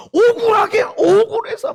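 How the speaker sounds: high, loud protest; worked-up, wronged outburst; aggrieved shouting. Retold or imitated. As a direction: cry out the injustice, reliving it. Relives shouting from feeling wronged.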